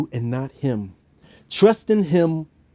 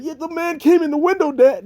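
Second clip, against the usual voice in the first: put-on high, eager kid's voice; young Bill's excuse; 12 semitones higher than usual. Little Bill fibbing to his father, high kid voice. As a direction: play the lying little boy, high. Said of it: "his father".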